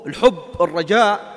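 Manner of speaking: ordinary talk, nothing marked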